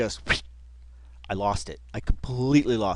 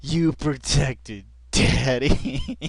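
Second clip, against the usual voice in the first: mock tender; much slower than usual. Repeats quote amused.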